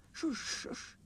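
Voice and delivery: whispered, high hushing; shushing whisper. A narrator's reading. Peter Sam hushing Sir Handel in a whisper. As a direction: hush him in a whisper. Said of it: "said Peter Sam".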